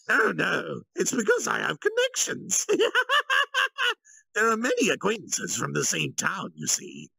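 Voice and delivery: very high, loud, giggling deflection; Yuki, laughing it off. Acted. Yuki dodges Kalgurio's question with a high laugh. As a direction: laugh lightly and brush the question aside.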